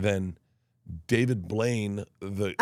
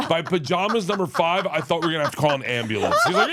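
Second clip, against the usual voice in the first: ordinary talk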